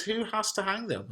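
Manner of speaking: high, lightly acted child's question. A narrator's reading. Son asks mother what a word means, questioning child. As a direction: lift the pitch for a curious young son.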